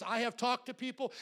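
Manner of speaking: usual speaking voice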